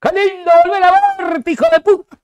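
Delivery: high, loud mock TV commentator voice; Viñolo impression. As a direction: ham up the pompous commentator greeting. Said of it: "¿Cómo dice Viñolo?". (imitando a Viñolo con voz alta y fuerte)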